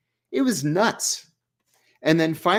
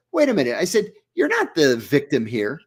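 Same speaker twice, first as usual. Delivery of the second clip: higher, heated confrontation; indignant retort; 7 semitones higher than usual. Retold or imitated. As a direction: push back hard on his self-pity. Confronting the prisoner angrily.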